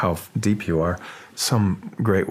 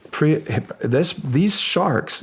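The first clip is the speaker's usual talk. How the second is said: ordinary delivery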